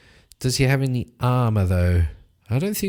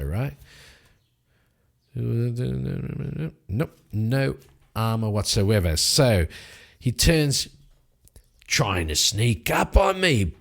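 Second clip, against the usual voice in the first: ordinary talk, nothing marked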